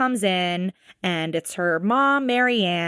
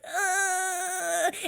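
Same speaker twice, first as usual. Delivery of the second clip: high, strained groan, quieter than her talk; pained groan; 11 semitones higher, 7 dB quieter than usual. Retold or imitated. Hurt pilot groaning as she is lifted up, pained groan. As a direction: let out a weak, hurting groan. Injured Rei being helped up.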